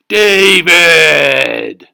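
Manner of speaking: huge loud bellow of a name; booming shout. Acted. Giant father calling his son, booming shout. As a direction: roar it like a giant. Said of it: "Then somebody called".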